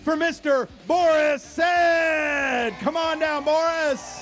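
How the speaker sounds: loud, drawn-out guest intro at a racetrack; announcer shout. Real, not acted. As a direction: hype up the crowd.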